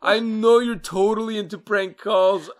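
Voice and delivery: loud, high-pitched outburst of disbelief; indignant, building to a high shout; indignant accusation. Real, not acted. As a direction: call them out, raising pitch and volume sharply. Mock-outraged accusation of co-host.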